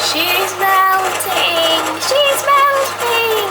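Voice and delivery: high, drawn-out melodramatic wail; mock-dramatic cry. Vlogger hamming it up over melting chocolate, mock-dramatic high cry. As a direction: stretch each word out high and loud, hamming it up.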